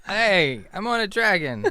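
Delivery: high, loud mock surprise; startled realisation. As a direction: call out as you find yourself on a dragon. Character finds himself on a dragon.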